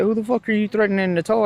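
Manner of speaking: fast, high, indignant challenge rising at the end; retold cussing out. Driver to cop threatening a tow, retold indignation. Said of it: "I ended up cussing this one out".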